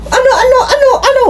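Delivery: high, frantic repeated question; frightened mother. Retold or imitated. Mother frightened by the fighting, high frantic stammer. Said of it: "kita mo sa sarili niya yung takot".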